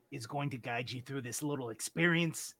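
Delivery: normal talking voice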